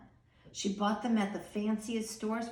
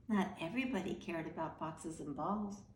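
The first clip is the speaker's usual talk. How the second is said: ordinary delivery, nothing marked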